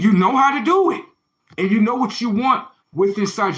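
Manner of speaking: pushed up high and loud. Real, not acted. Accusing rant, voice climbs.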